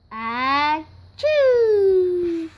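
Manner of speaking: ah builds, then a loud high chew; comic sneeze. Acted. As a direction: wind up and let the sneeze fly.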